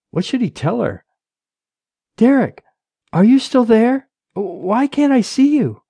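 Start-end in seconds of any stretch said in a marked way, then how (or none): 2.2-5.8 high-pitched, questioning wife; Rachel, anxious wife. A narrator's reading. Wife calling to her silent husband on the phone, anxious. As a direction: press him anxiously, pitched well up.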